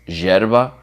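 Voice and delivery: Argentine pronunciation, drawn-out "zheh" opening YERBA; Argentine accent. Shown as a demonstration. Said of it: "With a Argentine accent".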